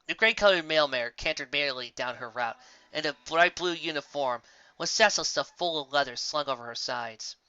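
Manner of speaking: ordinary delivery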